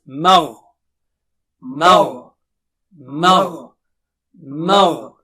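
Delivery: Magh, heavy throaty gh; correct magh. Shown as a demonstration. Teacher shows the right magh. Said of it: "So repeat three times".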